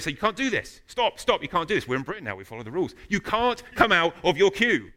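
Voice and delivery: imitated indignant scolding, high and loud; angry British driver. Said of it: "the angry British guy's car".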